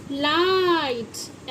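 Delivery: लाइट stretched slowly, long ai; drawn-out demo word. Shown as a demonstration. Teacher to pupils, slow drawn-out demo. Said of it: "long vowel sound".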